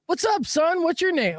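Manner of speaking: high, mock-fatherly greeting to a grown man; patronizing dad. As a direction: talk down to him like a kid. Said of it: "but I go".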